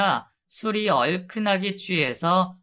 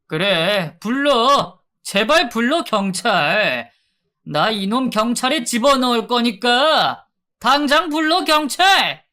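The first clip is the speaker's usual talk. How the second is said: loud, higher, daring yell; furious shout; 6 semitones higher, 6 dB louder than usual. Acted. Debt collector yelling at the wife to call the police, loud furious dare. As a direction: bark it back at her, loud and high.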